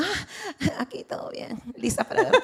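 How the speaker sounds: high, airy fake-casual answer; sugary pretend nonchalance; mock breezy reply. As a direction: pretend all is fine, lightly breathy.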